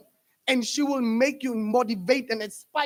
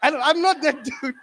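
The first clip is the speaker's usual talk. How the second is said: ordinary talk, nothing marked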